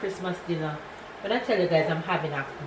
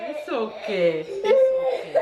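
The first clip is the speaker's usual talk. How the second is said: high soothing through a laugh; higher laughing comfort to her son; 10 semitones higher, 5 dB louder, slower than usual. Real, not acted. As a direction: comfort him while laughing.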